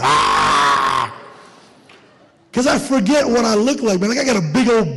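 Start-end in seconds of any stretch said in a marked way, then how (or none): 0.0-1.1 low, mock monster roar. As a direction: lunge with a growl.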